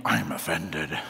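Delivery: very low mock-offended voice. Preacher mimicking someone saying they're offended, mock offended. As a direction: put on a deep voice for taking offence. Mocks reacting by taking offence.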